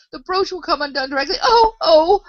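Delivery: high wail rising into loud cries; groaned out. A narrator's reading. The poor Queen foreseeing her brooch undone, wailing. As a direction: moan it, then cry out loudly. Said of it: "the poor Queen groaned out".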